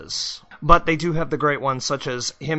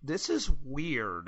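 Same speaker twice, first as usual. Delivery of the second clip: quietly bemused; 9 dB quieter, much slower than usual. Retold or imitated. Acting out the character in the mirror.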